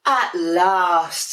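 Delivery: finally alone, pleased; relieved penguin. Acted. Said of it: "said Dudley".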